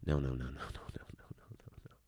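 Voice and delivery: low string of noes fading to a whisper; hushed repeated no. Real, not acted. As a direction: let the noes die away under your breath. Trailing refusal of that self-talk.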